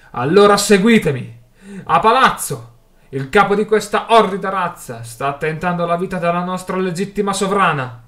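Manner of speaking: loud, high command to follow; full-voiced, urgent oratory; rallying battle cry. A narrator's reading. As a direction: shout the charge over the mob. Orator leads the crowd to attack.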